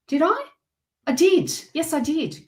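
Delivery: oh wait, yes I did; surprised realization. Real, not acted. Surprised self-check.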